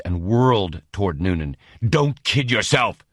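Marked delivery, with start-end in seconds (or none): 1.9-3.1 roaring. Acted. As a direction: bellow it at him. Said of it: "he roared".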